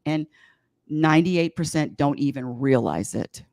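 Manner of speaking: normal talking voice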